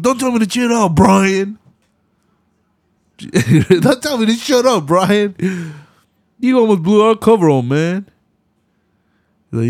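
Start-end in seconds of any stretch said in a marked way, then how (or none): 0.0-1.6 high hammed-up Roman retort; mock Roman. Roman snapping at Brian, imitated much higher. 3.2-8.1 high hammed-up Roman retort; mock Roman. Roman snapping at Brian, imitated much higher.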